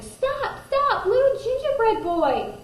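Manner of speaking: high, loud call to stop; old couple shouting after the gingerbread boy. A narrator's reading. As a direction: yell after the runaway cookie.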